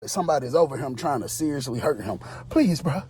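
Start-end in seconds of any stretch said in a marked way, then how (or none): 2.5-3.0 mock begging. High man begging again.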